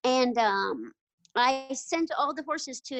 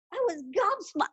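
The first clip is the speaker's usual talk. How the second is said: ordinary talk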